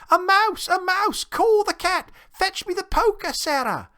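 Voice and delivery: high-pitched, loud shriek of alarm; very high, loud frantic calls for help; panicked exclaiming. A narrator's reading. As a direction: shriek like a cook who has seen a mouse.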